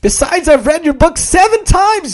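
high, gushing fan voice; young woman voice. Acted. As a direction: gush like an admiring fan. Cashier gushing.

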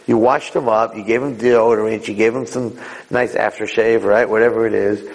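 normal talking voice

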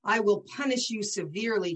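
ordinary talk

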